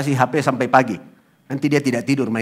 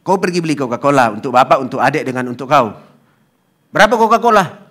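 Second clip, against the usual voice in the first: louder, scolding dad; father losing patience with his son, louder; angry father; 3 semitones higher, 7 dB louder than usual. Retold or imitated. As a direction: bark the question at the child. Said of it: "Bapaknya marah".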